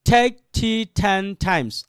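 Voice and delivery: slow, evenly spaced "take tea ten times"; slow reading. Shown as a demonstration. Teacher has students read it gently first, slow and measured. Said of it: "আস্তে পড়েন".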